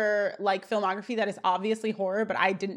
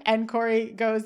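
ordinary talk

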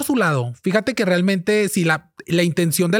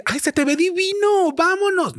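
much higher, slow gushing fake praise; hypocritical friend; 12 semitones higher than usual. Mocking a hypocrite praising a bad dress, high gushing. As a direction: gush over the dress, insincerely.

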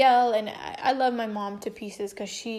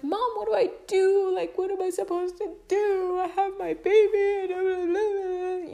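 high, whiny plea to mom; hammed-up tearful helplessness, running on; mock panicked wail; 9 semitones higher, 4 dB louder than usual. Daughter begging mom for help with lice, mock panic.

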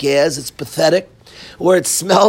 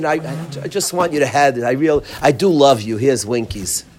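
mock tender parent; 7 semitones lower, faster than usual. Teacher mocking a parent who gives Winkies after punishing. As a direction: play the over-sweet apologizer. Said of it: "You say".

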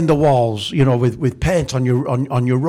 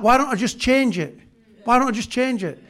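exasperated self-talk; 10 semitones higher than usual. Retold or imitated. Finally deciding to change it.

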